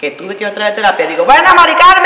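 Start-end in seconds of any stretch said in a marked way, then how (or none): 1.2-2.0 high, loud wail; hammed-up breakdown; wailing at therapist. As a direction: cry out the name in melodramatic despair.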